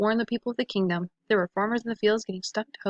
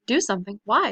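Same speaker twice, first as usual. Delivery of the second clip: usual speaking voice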